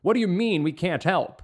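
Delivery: loud, angry royal demand; roaring king. Acted. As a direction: roar it like an indignant king. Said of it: "the king roared".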